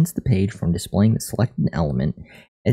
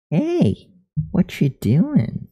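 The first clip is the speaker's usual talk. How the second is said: much higher cooing voice; high pet voice; 8 semitones higher, much slower than usual. Real, not acted. Talking to the cat.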